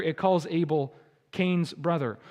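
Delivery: ordinary delivery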